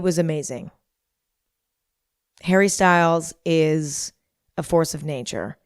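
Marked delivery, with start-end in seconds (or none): none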